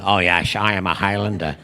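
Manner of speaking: deep Scottish voice with shushed s; the same Scottish slur for a supposed Spaniard; Sean Connery. Retold or imitated. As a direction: imitate the film star's low Scottish burr. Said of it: "he played a Spaniard".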